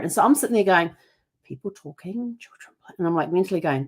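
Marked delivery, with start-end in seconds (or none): 1.4-2.9 soft mental checklist. Retold or imitated. Mentally listing sounds softly.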